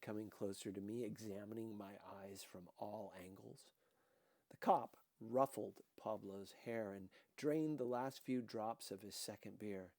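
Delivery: ordinary delivery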